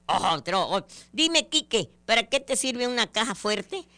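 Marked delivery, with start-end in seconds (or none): 1.1-3.9 higher questioning adult; asking a child. Retold or imitated. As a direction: quiz the little boy.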